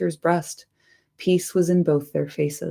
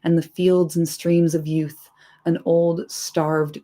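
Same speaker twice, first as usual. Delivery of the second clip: ordinary delivery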